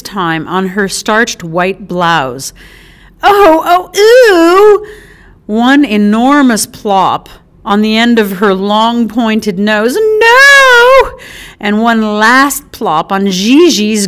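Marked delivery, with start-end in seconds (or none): 3.2-4.9 high, loud revolted squeal; disgusted squeal. Acted. Bird poop hits her again. 10.0-11.2 high, loud anguished wail; wailing cry. Acted. Poop lands on her nose.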